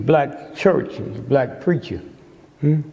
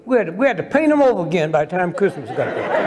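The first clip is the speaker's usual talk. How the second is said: ordinary talk, nothing marked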